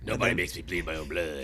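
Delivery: low, quiet menacing movie villain; movie villain quote. Retold or imitated. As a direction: deliver it like a smug movie villain. Quoting Dodgeball villain line.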